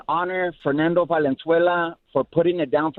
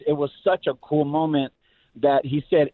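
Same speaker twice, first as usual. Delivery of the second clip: ordinary delivery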